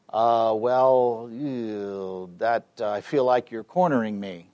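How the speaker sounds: drawn-out, reluctant filler words, then a reluctant admission; cornered hesitation. Retold or imitated. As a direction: imitate someone stalling uneasily. Acting cornered woman stalling after silence.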